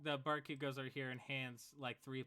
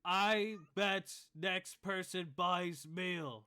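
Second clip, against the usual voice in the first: slow half-orc challenge; 7 semitones higher, 9 dB louder, much slower than usual. Acted. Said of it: "he goes".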